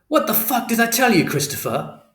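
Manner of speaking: loud, high furious shout. A narrator's reading. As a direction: bellow it at him in rage. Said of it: "This was much louder".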